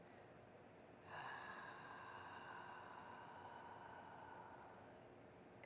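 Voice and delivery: audible constricted exhale through the throat; ocean throat breathing. Shown as a demonstration. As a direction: breathe out like fogging a mirror. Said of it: "give a little constriction to the back of the throat and then".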